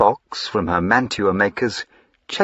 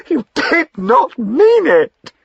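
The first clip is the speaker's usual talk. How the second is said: much higher, slow and coaxing; pleading; 7 dB louder, slower than usual. Acted. Wife with arms round her husband's neck, slow pleading.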